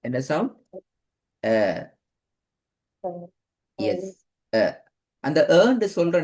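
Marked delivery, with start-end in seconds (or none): 1.4-1.9 அ gliding down low; downward-sloping அ. Shown as a demonstration. Teacher repeating the alif sound, அ falling. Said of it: "சரிவாகத்தான் கீழ் நோக்கி போகணும்". 4.5-4.8 அ gliding down low; downward-sloping அ. Shown as a demonstration. Teacher repeating the alif sound, அ falling. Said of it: "சரிவாகத்தான் கீழ் நோக்கி போகணும்".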